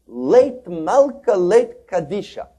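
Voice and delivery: loud, slow cry of despair; screamed cry. Retold or imitated. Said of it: "he screams out".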